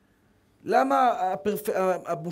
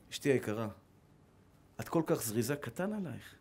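soft, much lower, gentle praise to his wife; tender husband; 12 semitones lower, 12 dB quieter than usual. Retold or imitated. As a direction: coax her softly, like a loving husband. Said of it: "בוא ננסה שיטה אחרת". (בעל רך ומעודד בשקט)

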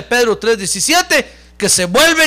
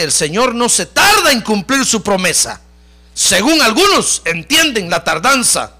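forceful scripture reading; 3 semitones higher, 5 dB louder than usual. Acted. Preacher reads God's promise to congregation, loud forceful declamation. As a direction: proclaim the verse, swelling in the middle.

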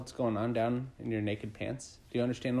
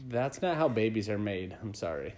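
usual speaking voice

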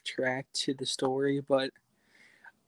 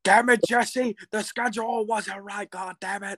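mock angry yell; 6 semitones higher than usual. Mock angry boss outburst.

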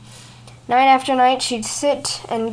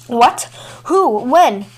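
louder startled rapid questions; alarmed questions; 6 dB louder, much slower than usual. A narrator's reading. Old man alarmed by the moon people's coming, louder startled questions. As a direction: fire them off in alarm. Alarmed father demands answers.

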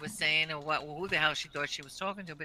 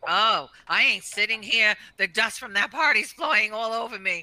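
complaining sister; 5 semitones higher, 9 dB louder than usual. Retold or imitated. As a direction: make a loud fuss about the dust. Sister complaining about party dust.

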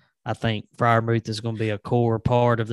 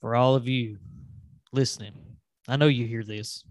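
normal talking voice

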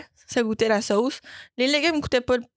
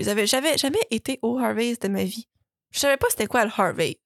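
ordinary delivery

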